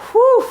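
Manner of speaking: high, loud whoo of effort mid-set; breathless high whoop between reps; exertion whoo. Real, not acted. Exhale between workout reps.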